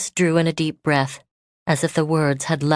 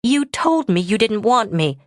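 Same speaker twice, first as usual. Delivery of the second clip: flung accusation; 5 semitones higher than usual. Acted. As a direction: throw the words at him. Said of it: "she flung at him".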